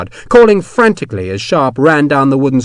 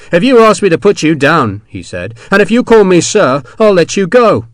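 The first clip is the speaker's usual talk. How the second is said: taunting offer; 7 semitones higher, 6 dB louder than usual. Acted. Sharp bargaining loudly.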